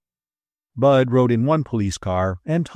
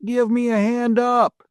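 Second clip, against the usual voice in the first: calling up; 13 semitones higher than usual. A narrator's reading. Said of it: "he called up".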